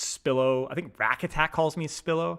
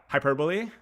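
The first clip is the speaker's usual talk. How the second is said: ordinary talk, nothing marked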